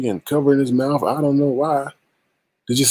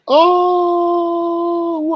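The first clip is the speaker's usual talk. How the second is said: high gloating oh; 3 dB louder than usual. Acted. Gloating over being right.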